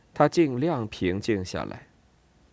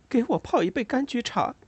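higher woman voice; 14 semitones higher than usual. A narrator's reading. Ada voiced asking for tea.

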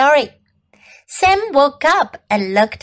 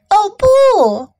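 high, louder cry of alarm; dismayed cry; 7 semitones higher, 5 dB louder than usual. A narrator's reading. Two friends after hitting the snowman, dismayed cry. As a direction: cry out, pitch and volume up. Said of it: "琼和鲍勃叫了起来".

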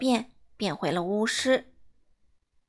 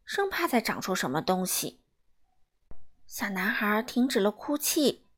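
plain reading voice

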